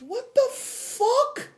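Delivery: high, slow, drawn-out what the fuck; squeaky, slowed-down shock; stunned disbelief. Real, not acted. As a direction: stretch it out in total disbelief. Shocked at the call.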